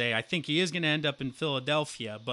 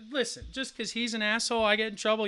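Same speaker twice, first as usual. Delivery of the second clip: indignant rant; 8 semitones higher than usual. Retold or imitated. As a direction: vent like you're wronged. Retold rant at coach.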